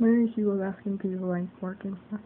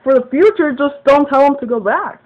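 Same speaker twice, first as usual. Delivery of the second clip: loud, higher, retold scolding; exasperated demand; 5 semitones higher, 9 dB louder than usual. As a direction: lay down the rule forcefully, reenacting. Acting out the quarrel.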